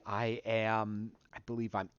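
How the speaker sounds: usual speaking voice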